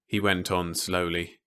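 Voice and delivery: plain reading voice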